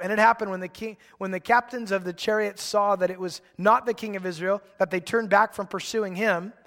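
ordinary talk, nothing marked

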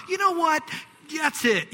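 high, loud fed-up shout; mock exasperation, shouted; exasperated outburst. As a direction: throw up your hands and shout. Imagined Moses losing patience.

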